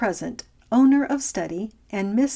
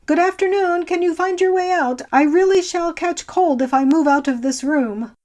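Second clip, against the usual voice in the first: higher and louder, pointedly said aloud; 8 semitones higher, 7 dB louder than usual. A narrator's reading. Old man loudly hurrying the visitor out, pointed and raised. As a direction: say it up and out for the guest to hear.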